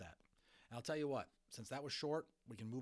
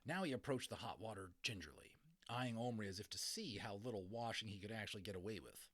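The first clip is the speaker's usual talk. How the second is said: ordinary delivery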